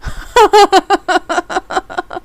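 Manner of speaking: long peal of high, loud laughter; relieved laugh. Real, not acted. Player amused at being scared by a survivor, relieved laugh. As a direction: keep laughing, high and helpless.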